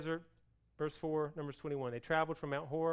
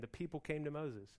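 usual speaking voice

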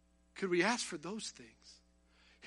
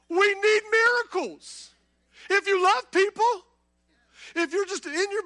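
higher and louder, urgent sermon cry; full-force preaching; 8 semitones higher, 6 dB louder than usual. Acted. Preacher urging the congregation to pray for miracles, impassioned. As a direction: preach it out, rising and pleading for miracles.

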